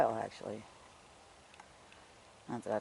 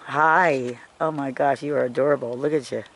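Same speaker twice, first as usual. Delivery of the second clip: louder, gushing greeting; delighted coo; 3 semitones higher, 13 dB louder than usual. Real, not acted. Vlogger greeting a cute creature, loud gushing coo. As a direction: gush over the cute little thing.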